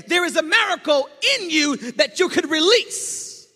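loud, high, fervent preacher's cry; preaching at full force. Acted. Pastor proclaiming to the church, loud fervent preaching. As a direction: proclaim it to the whole congregation.

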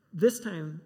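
usual speaking voice

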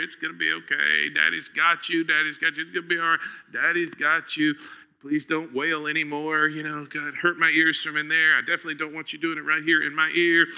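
soft, low hushing dad voice; calm a frightened little girl; soothing a child. Retold or imitated. Father soothing a crying child.

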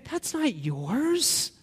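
God quietly telling us it isn't ours; God's gentle rebuke. Acted. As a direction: say it slowly and quietly, like a knowing father. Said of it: "God's looking and saying".

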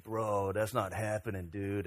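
low, laid-back surfer drawl imitation; quiet Malibu surfer-dude impression; beach bum. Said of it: "that's how he would talk".

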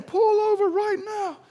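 loud, very high imitation of an irate driver; mock yelling. Driver ordering him to the roadside, loud high mock yell. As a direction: yell the order like a road-rager. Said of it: "And so he's saying".

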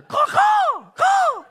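high loud crow cawing. Retold or imitated. Crows answering the preacher, loud cawing. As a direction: call like a crow. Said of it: "then they'll answer".

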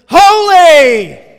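huge high cry of Holy; angelic shout. Retold or imitated. Pastor voicing angels crying holy at full force. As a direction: belt it like an angel in heaven.

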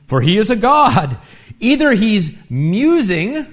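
hammed-up mockery of a sleeping god; sarcastic taunt. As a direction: needle them with fake helpfulness. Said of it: "He's taunting them".

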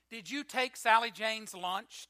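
loud, stern teacher demanding an answer. Acted. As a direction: press the child hard for the truth. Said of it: "demanded the teacher".

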